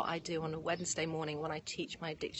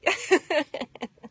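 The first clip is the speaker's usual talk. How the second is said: loud, high burst of laughter; real laugh; 9 semitones higher, 12 dB louder than usual. Real, not acted. Host joking about a cup of tea, loud laugh.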